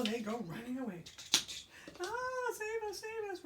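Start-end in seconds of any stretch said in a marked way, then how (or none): 2.0-3.4 very high squealing plea; little pigs fleeing the wolf; high panicked cry. Acted. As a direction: shriek for help while running. Pigs screaming running off.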